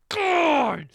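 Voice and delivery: loud, drawn-out, exasperated; exasperated cry. Real, not acted. Fuming at the anime, a loud slow exasperated cry. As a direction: groan it out in frustration. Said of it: "I am furious".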